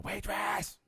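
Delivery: high call across the bar; mock bar-room call. Friend calling waitress again.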